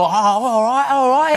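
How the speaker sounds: exaggerated Cockney, loud and high; full-on Cockney. Actor overdoing Cockney for the American job, broad and loud Cockney. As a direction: lay the Cockney on thick. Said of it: "I'm giving it the full".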